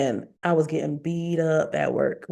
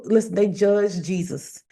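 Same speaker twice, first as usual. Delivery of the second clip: normal talking voice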